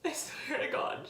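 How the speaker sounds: breaking into a whisper through tears; the vow half-whispered as she cries; tearful whisper. Real, not acted. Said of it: "I'm sorry to get emotional".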